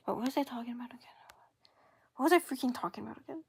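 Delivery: quick breathless whisper to self; fast whisper. Real, not acted. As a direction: mutter it fast, lost. Lost train of thought.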